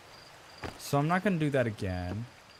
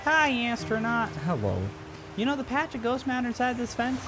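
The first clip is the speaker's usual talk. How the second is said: a hint of a pleading child, higher; lightly voiced kid; 7 semitones higher than usual. A child begging the astronaut for the signal scope, higher and eager. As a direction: read the alien kid's lines, a little higher and eager.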